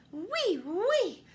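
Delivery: high, bright eager oui oui; happy agreement. Acted. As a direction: agree with glee. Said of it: "agreed happily".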